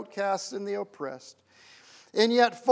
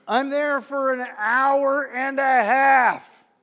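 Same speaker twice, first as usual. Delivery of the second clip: loud, drawn-out exasperation; slow, raised-voice grumble; exasperated complaint; 3 semitones higher, 6 dB louder, much slower than usual. Retold or imitated. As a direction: stretch out every word of the endless wait. Exasperated retelling of bank wait.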